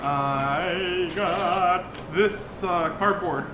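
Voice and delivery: long, teasing build-up to a joke reveal; drawn-out mock reveal. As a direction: stretch each word out very slowly.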